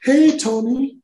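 very high falsetto phone greeting; imitated studio head. Studio head calls actor next morning, falsetto greeting. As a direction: imitate the director's wife in a high-pitched voice. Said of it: "she was the first call I got".

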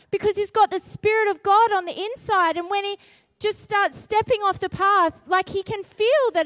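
forceful, urgent testimony; impassioned preaching. Real, not acted. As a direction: preach it hard and high with conviction.

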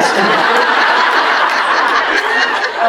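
long breathy burst of laughter; delighted laugh. Real, not acted. Delighted laugh after the quote.

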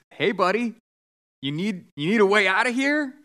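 lightly acted rescuer calling out. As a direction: call up an offer of help. Rowboat man calls up to roof.

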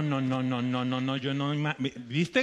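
ordinary talk

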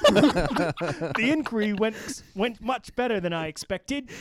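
high, loud stab at an Australian accent; attempted Australian accent. Shown as a demonstration. Host reading senator's quote on co-hosts' dare, attempted Australian accent. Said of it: "you have to read it in an Australian accent".

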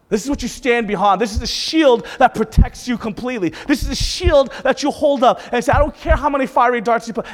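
loud, high sermon delivery; full-force preaching. Real, not acted. Preacher urging the congregation to hold up faith, full-force preaching. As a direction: drive each phrase home to the congregation.

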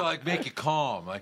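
ordinary talk, nothing marked

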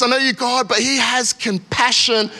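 usual speaking voice

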